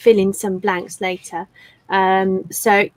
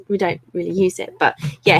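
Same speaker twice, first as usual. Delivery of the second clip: ordinary talk, nothing marked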